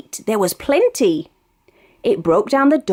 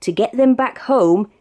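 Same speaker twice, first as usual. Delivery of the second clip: ordinary delivery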